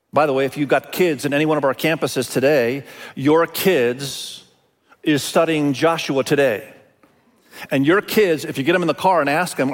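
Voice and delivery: ordinary talk, nothing marked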